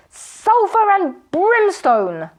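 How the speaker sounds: high, slow enraged cry; furious magician. A narrator's reading. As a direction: roar it out in a frenzy of rage. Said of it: "was foaming at the mouth".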